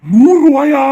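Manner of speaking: high, loud incredulous question; shocked disbelief. Acted. Brother hearing how much his sister lost, shocked. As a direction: echo the sum in outrage.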